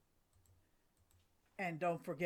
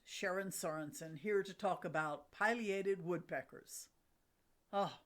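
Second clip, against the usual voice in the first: ordinary delivery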